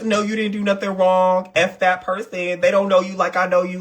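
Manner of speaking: lightly mocking fawning friend voice. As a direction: coo like a yes-man friend.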